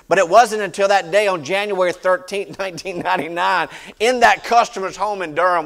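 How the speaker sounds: preaching. Real, not acted. Preacher testifying to the congregation about his thirties, preaching loudly. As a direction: preach it out with rising force.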